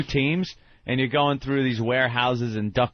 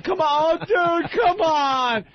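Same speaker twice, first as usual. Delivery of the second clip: high-pitched pleading whine; whiny high pleading; much slower than usual. Retold or imitated. Imitating the gamer begging his teammate, high whiny plea. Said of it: "the guy's going".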